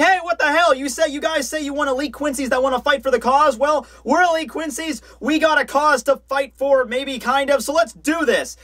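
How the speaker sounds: high-pitched protest, hammed up; mock shouting. Boy challenging the recruiting officer, high mock shouting. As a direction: call out indignantly in a high put-on voice. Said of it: "he begins shouting over at Hubert".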